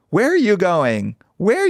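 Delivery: high-pitched, drawn-out campy dog owner; Truman Capote type. Retold or imitated. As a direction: call after the dog like a fussy Capote. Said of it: "this Truman Capote ass dude".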